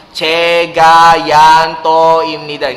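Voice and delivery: loud, drawn-out syllable-by-syllable reading; verbalized reading aloud. Shown as a demonstration. Teacher showing how not to read in an exam, exaggerated loud reading aloud. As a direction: sound it out loudly, stretching each syllable. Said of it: "Hindi ka naman magbabasa ng ganun".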